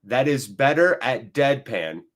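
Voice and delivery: slow, deliberate phrases with pauses between; emphatic declaration. Real, not acted. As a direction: start loud, then settle down to a measured close. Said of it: "just so everyone understands".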